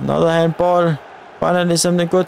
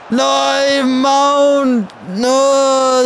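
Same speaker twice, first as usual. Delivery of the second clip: dismayed cry; 7 semitones higher, much slower than usual. Real, not acted. Dismayed at a bad pass.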